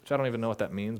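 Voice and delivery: ordinary delivery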